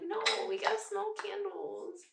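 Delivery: high, soft mock whine; high-pitched, small-voiced protest; playful refusal. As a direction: gently talk back to a nagging stomach. Answers her own stomach in play.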